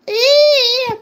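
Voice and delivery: a sudden very high, loud squeal of dismay. Real, not acted. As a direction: let out a piercing squeak of alarm. Reacting to tornado news.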